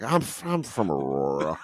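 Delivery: imitated drunken admission. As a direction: confess it with a tipsy stammer. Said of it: "getting drunker and drunker".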